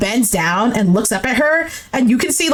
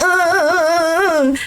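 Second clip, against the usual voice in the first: higher repeated uh-uh-uh; mock tantrum noises; imitated whine; 3 semitones higher than usual. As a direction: mimic a foot-stomping whine. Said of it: "You went".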